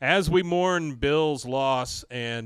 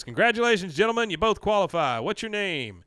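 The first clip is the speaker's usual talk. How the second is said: raised, louder official congratulations; contest announcer; 7 semitones higher, 5 dB louder than usual. Acted. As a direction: announce it grandly to the qualifiers. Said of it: "He goes".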